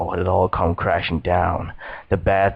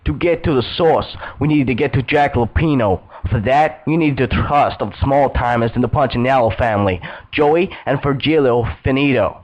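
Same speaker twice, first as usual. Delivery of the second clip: higher-pitched second character talking shop; Alex; 6 semitones higher than usual. Acted. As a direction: lift the voice well above the narrator's. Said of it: "My last meeting with Alex".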